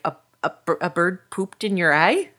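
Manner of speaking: halting, stammered question rising at the end; puzzled little boy. Acted. Little boy pauses at pirate's answer, baffled. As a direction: stumble over it in disbelief. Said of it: "the little boy pauses".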